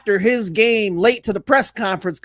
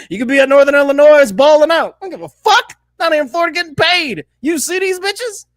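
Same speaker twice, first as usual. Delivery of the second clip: loud player brag; 6 semitones higher, 5 dB louder than usual. Retold or imitated. Voicing player in Florida.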